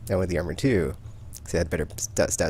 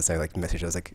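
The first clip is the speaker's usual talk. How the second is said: ordinary talk, nothing marked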